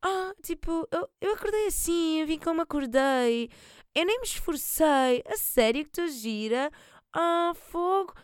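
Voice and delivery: high, breezy fake modesty; faux-casual voice. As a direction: play it coy and careless. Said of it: "é mesmo para parecer tipo".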